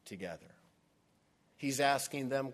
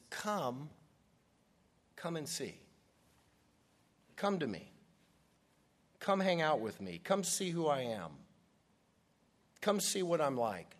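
higher, pleading welcome; Jesus calling the disciples, warm and pleading; warm invitation; 5 semitones higher than usual. Acted. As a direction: beckon them close like a friend. Jesus's heart voiced as invitation.

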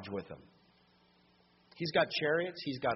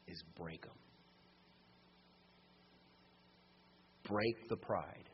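hushed preaching; 6 semitones lower, 6 dB quieter than usual. Acted. Preacher to congregation, low and hushed. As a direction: let it sink in quietly.

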